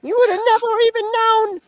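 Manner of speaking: nagging mother. Retold or imitated. Mocking mom voice continued.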